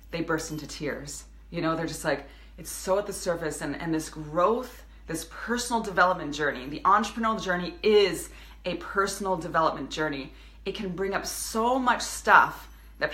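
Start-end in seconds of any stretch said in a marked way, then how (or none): none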